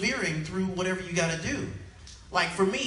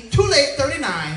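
curt clerk; 6 semitones higher, 6 dB louder than usual. Acted. Clerk cuts off latecomer.

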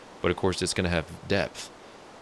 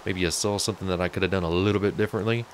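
ordinary delivery

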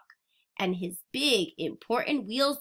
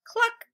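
hen cluck; 12 semitones higher than usual. Acted. Animal sound in picture book.

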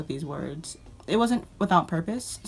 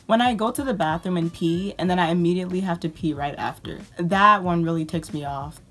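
ordinary talk

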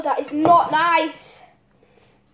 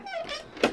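sharp gasp; 8 dB quieter than usual. Real, not acted. Onion sting.

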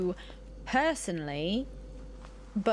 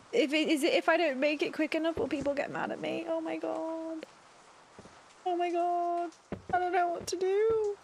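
panicky; 7 semitones higher than usual. Real, not acted. New worker panicking over burnt hot dogs.

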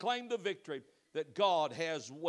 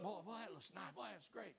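soft admiring murmur; 15 dB quieter than usual. Retold or imitated. Voicing a window shopper admiring.

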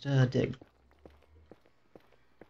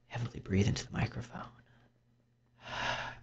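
mock ASMR whisper; 7 dB quieter than usual. Shown as a demonstration. Gamer keeps the mock ASMR whisper going. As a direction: breathe the words into the mic. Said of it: "deep A S M R voice".

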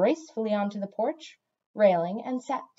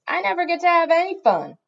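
high, loud wail of grievance; hurt pride; 9 semitones higher, 7 dB louder than usual. A narrator's reading. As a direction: protest like someone unfairly left out. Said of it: "Radiating hurt pride".

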